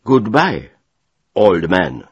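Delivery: loud, curt parting shot; defiant farewell. A narrator's reading. Officer leaves after his ultimatum, loud and defiant. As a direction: toss a loud goodbye over the shoulder.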